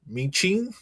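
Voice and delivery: high, questioning mock-Chinese "Michin"; unsure stab at a name in a put-on Chinese accent; accidental mock-Chinese accent. Real, not acted. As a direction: say "Michin" as "mee-CHEEN", mock-Chinese, rising. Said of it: "Why did I throw the accent".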